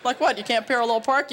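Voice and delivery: ordinary delivery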